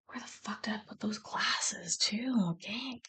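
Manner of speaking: breathy muttered aside; whisper. Real, not acted. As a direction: whisper it to yourself while searching. Searching for glasses, muttering aside.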